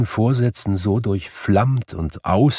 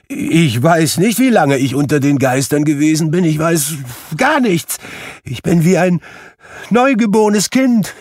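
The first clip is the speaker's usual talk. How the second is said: much higher and louder, building to cheering; childlike joyful shouting; 12 semitones higher, 6 dB louder than usual. Acted. Scrooge whoops with childlike joy on Christmas morning. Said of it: "rief Scrooge".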